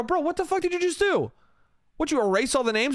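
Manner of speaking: high-pitched incredulous outburst, re-enacted; outraged disbelief. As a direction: confront a drunk who just wrecked things. Confronts man who erased names.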